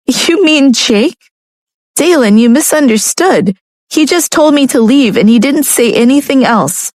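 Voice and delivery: protest, hurt and puzzled; upset young woman. A narrator's reading. Narrator voicing Lucia.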